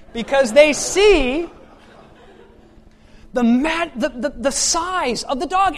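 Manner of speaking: loud, high storytelling at full force; animated preaching story. Acted. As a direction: paint the scene for the whole room.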